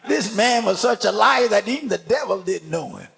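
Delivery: ordinary talk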